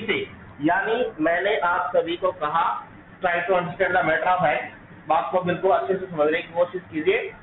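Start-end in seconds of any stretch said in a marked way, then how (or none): none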